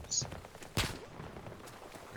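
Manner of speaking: whispering. Real, not acted. Sneaking up on an enemy.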